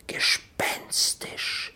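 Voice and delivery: slow, breathy whisper; eerie whisper. A narrator's reading. Narrator sets the eerie silence in the wood, whispered slowly. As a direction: hush it out like a ghost story.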